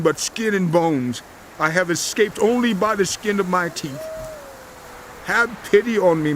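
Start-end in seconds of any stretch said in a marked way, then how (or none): 5.2-6.3 raised, begging plea; pleading for pity. Acted. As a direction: beg them for mercy. Job begs his friends.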